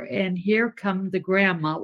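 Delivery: ordinary talk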